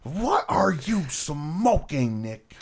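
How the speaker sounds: high, drawn-out incredulous cry; Mean Gene. Retold or imitated. Quoting the announcer's reaction to Nick, high appalled disbelief. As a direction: stretch it out slowly in high-pitched disbelief. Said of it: "you're so convincing".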